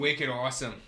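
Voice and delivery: a hint of Boston accent on wicked awesome; light Boston twang; mock Boston accent. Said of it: "you guys got accents?".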